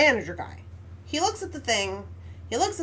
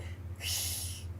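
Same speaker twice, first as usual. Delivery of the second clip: breathy cat hiss, no voice; imitated cat hiss; 13 dB quieter than usual. Showing how the cat hissed at the child, breathy hiss. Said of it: "and hissed".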